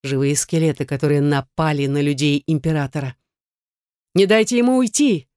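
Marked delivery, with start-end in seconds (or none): 4.1-5.3 barked order. Acted. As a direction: bark it at the men. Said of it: "рявкнул глава дознавателей".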